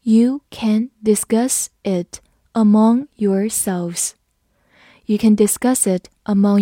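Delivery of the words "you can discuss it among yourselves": slow, careful reading for learners. Shown as a demonstration. Teacher reads slowly for the class. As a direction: read it slowly, word by word. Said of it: "好，我们慢读一遍。".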